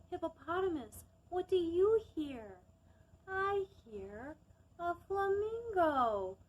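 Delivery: put-on lively storytime voice for young listeners; reading to children. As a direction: read it to a circle of little kids. Reads picture book to children.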